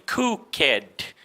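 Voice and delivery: cooked as two beats, cook-ked; wrongly voiced E. Shown as a demonstration. Teacher showing what never to say, cooked with an extra syllable. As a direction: sound the E in cooked, slowly. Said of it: "You never say".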